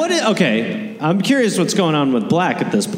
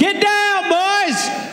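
mock panicked warning; 14 semitones higher, much slower than usual.

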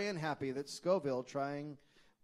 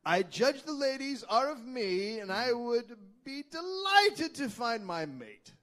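high, slow grand Charles; slow, lofty and self-assured; 8 semitones higher, 5 dB louder, slower than usual. Retold or imitated. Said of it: "You got your Charles voice on again".

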